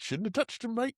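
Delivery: put-on a light cockney jeer in an English accent; mock English accent. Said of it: "Which I can do the accent here".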